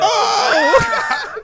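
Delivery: loud, high drawn-out "Oh!"; mock pained yell. Reacting to a covid swab, mock high yell. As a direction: cry out high and loud, breaking into laughter.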